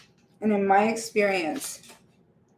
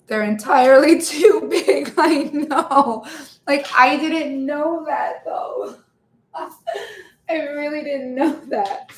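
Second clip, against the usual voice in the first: real laughter breaking through, higher pitch; laughing; 6 semitones higher, 5 dB louder than usual. Real, not acted. Streamer picturing a viewer's blunt comment, laughing. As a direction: crack up while saying it, voice climbing. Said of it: "I'm just laughing 'cause I'm".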